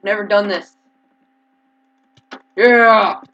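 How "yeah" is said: loud victory yell; triumphant yell. Real, not acted. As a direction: cheer the kill loudly. Win in fight.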